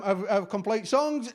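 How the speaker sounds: normal talking voice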